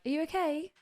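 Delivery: high, gentle mock concern; lightly voiced worried groom. Groom checking on sick planner.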